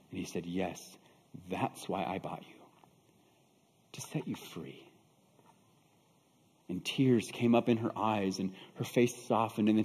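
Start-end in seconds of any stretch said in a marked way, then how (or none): 0.4-4.8 very soft, low and paused; hushed, deliberate reassurance; tender confirmation. Acted. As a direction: confirm it gently, almost under the breath. Buyer tenderly confirms he freed her.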